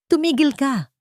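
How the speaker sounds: high, trembling stop; frightened order. Acted. Jane ordering Marco to stop, high and scared. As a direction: plead him to stop. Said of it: "ang boses ay nanginginig".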